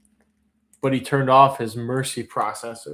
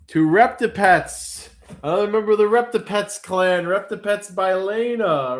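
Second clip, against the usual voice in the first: excited announcement; 7 semitones higher, 3 dB louder than usual. Real, not acted. Announcing the auction winner.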